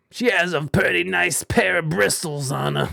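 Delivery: hammed-up British geezer; mock British cheeky chap; British accent. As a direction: put on a bad British accent. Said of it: "do a British accent".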